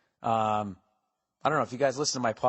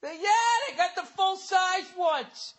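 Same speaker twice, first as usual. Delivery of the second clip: put-on excited kid yelling. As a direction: shout the news like a thrilled kid. Acting out kids spreading candy news.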